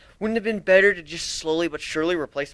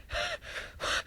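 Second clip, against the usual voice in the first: ragged gasp; 12 dB quieter than usual. Acted. Changeling breathing heavily between screams.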